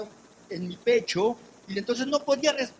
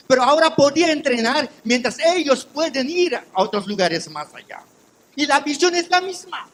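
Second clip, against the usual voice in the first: loud, rising preacher's testimony; full-force preaching; 3 semitones higher, 9 dB louder than usual. Acted. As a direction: preach it out to the church.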